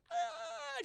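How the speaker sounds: high, soft strangled mock scream; silenced scream. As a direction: scream tiny and high, as if muted. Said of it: "there's no volume".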